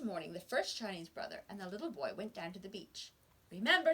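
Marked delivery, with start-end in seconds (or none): 3.5-3.9 higher, louder warning; stern reminder. A narrator's reading. Brother warning the boy before fishing, stern. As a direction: remind him firmly.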